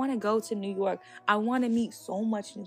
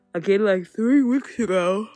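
talking through a yawn; 7 dB louder than usual. Real, not acted. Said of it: "Sorry, I'm yawning".

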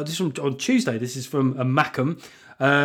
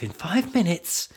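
whisper; 3 semitones higher, 4 dB quieter than usual. Pretend. Whispered mock wife rule.

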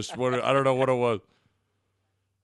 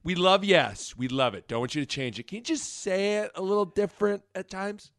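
imitated boss request; 5 semitones higher, 5 dB quieter than usual. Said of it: "someone talked to you about".

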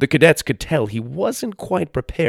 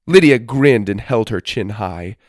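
ordinary delivery, nothing marked

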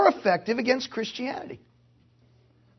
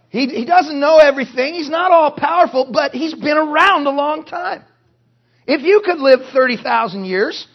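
raised, loud pulpit voice; forceful preaching; 4 semitones higher, 7 dB louder than usual. Real, not acted. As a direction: press the point hard to the congregation.

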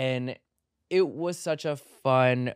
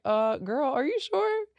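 high, rising sassy disbelief; Ali's doubtful "girl". Retold or imitated. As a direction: camp doubt, voice climbing on "are you sure". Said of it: "that's exactly how Ali said it".

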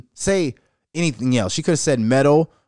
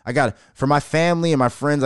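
usual speaking voice

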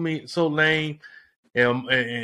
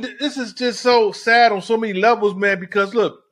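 ordinary talk, nothing marked

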